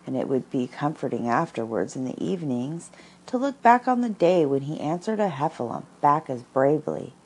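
ordinary delivery

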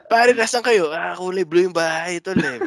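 hammed-up caller giving lazy directions; mock friend on phone. Teasing friends about lazy directions, hammed-up caller voice. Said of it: "code name nyo lang yun dahil mga tamad kayo".

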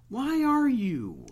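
high slow drawn-out whine; exasperated. Pretend. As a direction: stretch out the complaint. Ranting at slow drivers.

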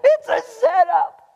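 high, loud, laughing shouts; screaming warning. Retold or imitated. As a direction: yell a warning at the screen. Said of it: "Every one of us are screaming".

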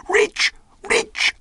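very high, heated castaway; hot insistence. A narrator's reading. Castaway repeats his claim to the doubting boy, hotly. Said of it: "he repeated the statement hotly".

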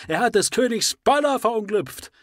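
high, loud indignant cry; outraged shout. Acted. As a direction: shout the charge at the crowd. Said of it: "Das kann besser interpretiert werden".

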